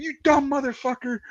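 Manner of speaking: high, strained mock rage; imitated angry cursing. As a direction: act out a crewmate chewing out the driver. Crewmate cursing the driver in story.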